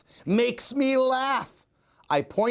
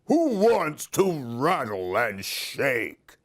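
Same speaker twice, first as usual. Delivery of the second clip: a lightly voiced party monster; cheeky monster calling out; mild monster voice from the cake; one-eyed monster; 3 semitones lower than usual. A narrator's reading. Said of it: "a one-eyed monster pops out".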